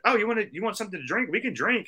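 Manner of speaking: very high, eager offer; imitated woman. Woman offering him a drink, high eager imitation. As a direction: jump up high as the keen woman.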